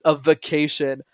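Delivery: loud, indignant customer insisting Tony stay on topic; indignant demand. Retold or imitated. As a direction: firmly demand what you paid for. Indignant seminar attendee scolds Tony.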